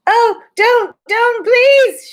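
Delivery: very high, loud desperate plea; high desperate shout; Edmund shouting. A narrator's reading. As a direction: cry out to stop her. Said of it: "shouted Edmund".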